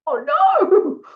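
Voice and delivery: high, loud mock dismay; retold dismay. Nurse realising the assistant is crying, acted-out dismay. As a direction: wail it in exaggerated regret. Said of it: "And I was like".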